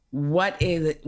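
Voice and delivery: normal talking voice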